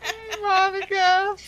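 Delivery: high, slow teasing repeat; mock chirpy echo. Listener teasing the story's mom, slow high mock greeting. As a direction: drag it out mocking the mom. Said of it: "listener echoes the wrong name".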